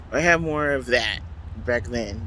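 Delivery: normal talking voice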